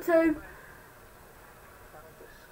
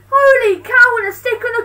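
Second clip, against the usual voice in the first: exasperated exclamation; 7 semitones higher, 4 dB louder than usual. Real, not acted. Exasperated at the match.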